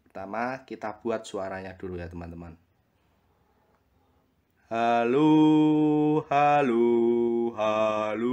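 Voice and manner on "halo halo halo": calling out loud, mic test; loud hello. Shown as a demonstration. Testing the recording, calling out.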